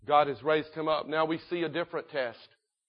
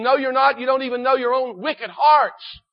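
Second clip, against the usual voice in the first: loud, raised-pitch preacher's rebuke; rough rebuke; 8 semitones higher, 9 dB louder than usual. Acted. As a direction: cut down their claim forcefully, as Joseph. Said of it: "He spoke roughly to them".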